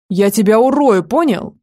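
loud menacing threat, hitting урою hard. Acted. Older brother threatening the boy who hurt his sister, loud threat. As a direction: snarl it out like you mean the beating.

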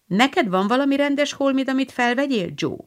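put-on a prim young girl asking; little sister Amy. Amy asks Joe. (magasabb kislányhang)

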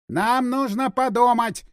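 high, slow, stretched-out shout. A narrator's reading. Vyacheslav answers from the roof, shouting. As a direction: call it down slowly from afar, pitched high. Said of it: "прокричал Вячеслав".

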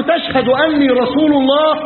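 high, slow interrogating question; demanding interrogator. Acted. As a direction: press the question, pitched high and drawn out. Acted interrogation by Musaylima. (سؤال استجواب بنبرة عالية متمهلة)

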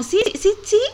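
lightly voiced eager friend, high; quick high mock yeses; playful impression of the friend; imitating Lola. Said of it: "Lola está como".

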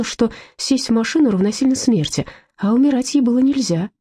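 ordinary delivery

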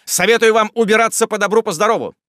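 loud, high, brazen dismissal; bold warning. A narrator's reading. Jingle tells the gentlemen to leave, bold warning. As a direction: send them packing boldly.

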